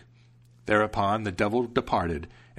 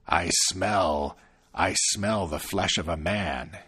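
lower, menacing devil; sniffing devil; 4 semitones lower, slower than usual. Acted. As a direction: sniff out the human, suspicious.

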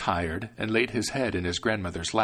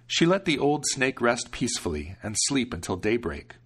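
ordinary delivery

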